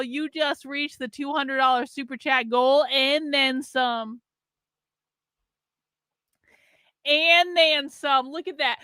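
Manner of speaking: put-on Southern accent. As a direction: draw the words out in a Southern accent. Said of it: "drunk and talking in a Southern".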